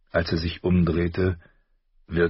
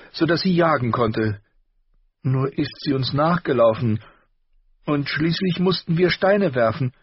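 put-on much higher, louder, ashamed girl; distressed young girl; 11 semitones higher, 4 dB louder than usual. A narrator's reading. Arya confessing about her wolf to her father, high and distressed. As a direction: rush through the shame, pleading. Arya's long tearful confession.

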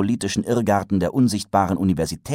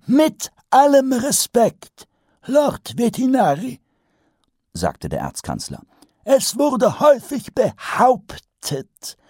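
put-on pompous old wizard; 4 dB louder than usual. A narrator's reading. Archchancellor lectures Vetinari on dragons, high and pompous. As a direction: hold forth like a self-pleased old professor.

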